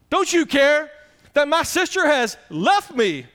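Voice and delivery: high, loud and slow, a pleading cry to the Lord; Martha's complaint. Acted. Martha complaining to Jesus, high pleading voice. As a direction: plead like an overworked sister.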